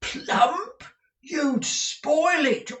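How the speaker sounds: high, loud appalled cry; the manager cries out in horror; horrified exclamation. A narrator's reading. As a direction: recoil at the very idea. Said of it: "quite horrified".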